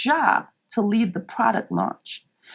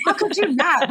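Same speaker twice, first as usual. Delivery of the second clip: mock smug pitch; 8 semitones higher than usual. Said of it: "So here was this".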